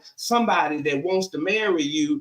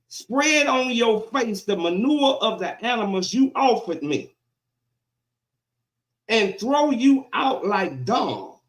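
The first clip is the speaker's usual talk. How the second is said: slow, emphatic pulpit reading; preaching God's rebuke; 4 semitones higher than usual. Acted. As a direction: punch out each phrase, pausing between.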